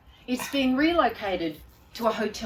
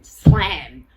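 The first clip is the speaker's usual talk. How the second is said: much louder, slow and punched; slam; 2 semitones lower, 14 dB louder than usual. Retold or imitated. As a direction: bang the word out like a door. Slamming the door.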